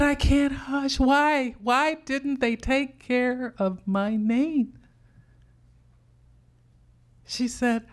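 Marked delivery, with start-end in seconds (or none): 0.0-4.8 put-on high, upset child's voice, slowing on the last question; grieving child. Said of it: "I said".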